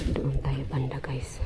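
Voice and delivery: hushed whisper so nothing hears; whispering to viewers in a spooky place. Real, not acted. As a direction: breathe the words, no voice. Exploring a creepy place, hushed.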